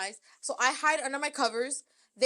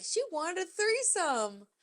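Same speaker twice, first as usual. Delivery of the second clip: high, slow, lightly mocking; mocking gossip quote; 6 semitones higher, slower than usual. Mimicking the people gossiping, mildly mocking. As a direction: sneer it like the gossipers. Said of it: "Everyone talks shit like".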